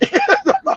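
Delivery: breathless high-pitched wheeze of laughter; cracking up silently, breath squeaking; high wheezing laugh. Real, not acted. Comedian wheezing at his own story, high laugh.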